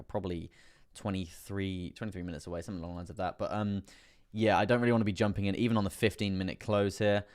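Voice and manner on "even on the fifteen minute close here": ordinary talk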